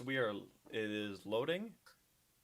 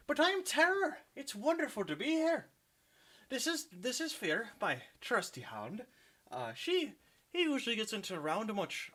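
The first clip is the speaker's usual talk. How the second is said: high, hammed-up Irish leprechaun accent, much louder; a cheeky stage Irishman, pitched way up; bad Irish accent; 10 semitones higher, 5 dB louder than usual. The player voices Terror introducing himself to the party, in a bad high Irish accent. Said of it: "Don't at me for the bad Irish accent".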